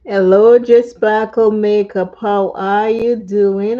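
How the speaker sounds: loud, bright hello to viewers; loud greeting. Real, not acted. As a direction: call out a hearty hello.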